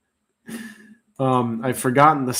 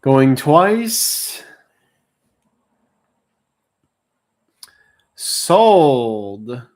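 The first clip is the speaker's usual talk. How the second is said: louder auctioneer announcing bids; auctioneer call; 7 dB louder, much slower than usual. Real, not acted. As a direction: call out going once, going twice.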